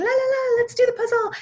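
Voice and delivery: high, fast, sing-song la la la; breezy chirp to pull a child's attention away; sing-song distraction. Shown as a demonstration. Mom distracting a hitting child with a puzzle, sing-song.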